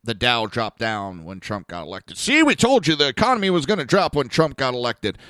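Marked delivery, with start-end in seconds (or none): 2.2-5.2 mocking imitation. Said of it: "just as they say".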